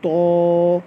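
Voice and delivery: तो with a thick, heavy t. Shown as a demonstration. Teacher showing children a heavy, thick तो. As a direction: give तो full weight. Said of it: "तो को मोटा करके पढ़ेंगे".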